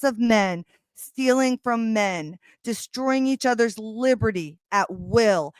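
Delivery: slow, weighty reading of a passage; solemn book reading; reading aloud. Acted. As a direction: read it like a grave pronouncement. Reads dramatic book passage aloud.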